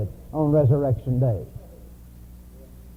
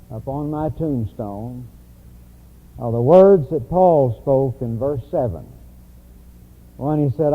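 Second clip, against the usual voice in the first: ordinary talk, nothing marked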